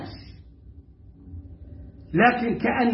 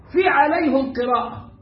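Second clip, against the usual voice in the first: ordinary delivery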